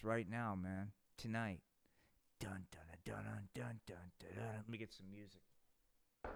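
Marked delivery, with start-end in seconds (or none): 2.4-4.6 dramatic dun dun dun. Acted. Dramatic reveal sting.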